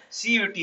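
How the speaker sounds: सीयूईटी spelt out letter by letter, falling tune; correct reading. Shown as a demonstration. Teacher repeating the correct letter-by-letter सीयूईटी. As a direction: say सीयूईटी as four letters, not क्यूट. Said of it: "इसको क्यूट बोलने लगे हैं".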